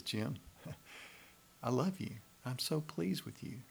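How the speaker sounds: God's gentle delight in Jim; soft tender love with a laugh. Pretend. God speaks to Jim at table.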